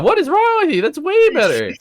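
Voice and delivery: playfully scandalised at the question; mock outrage. Real, not acted.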